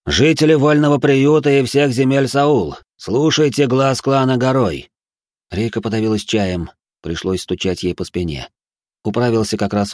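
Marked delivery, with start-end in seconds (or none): 0.0-4.9 loud, slow, echoing proclamation; booming voice from the sky. A narrator's reading. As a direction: boom it down from the heavens. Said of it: "прогремел голос".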